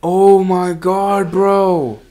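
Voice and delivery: long drawn-out disbelief; slow, reeling reaction; stunned. Real, not acted. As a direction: stretch it out slowly, stunned. Stunned by events in game.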